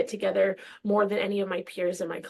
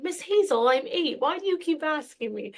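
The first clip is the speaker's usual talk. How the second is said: put-on child self; 7 semitones higher than usual. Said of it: "And I'd be like".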